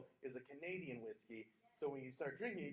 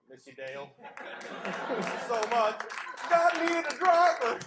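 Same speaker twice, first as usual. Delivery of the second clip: hammed-up loud, high wailing lament; slow, loud mock mourning; mock grief; 7 semitones higher, 12 dB louder, slower than usual. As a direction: overplay the grieving friend.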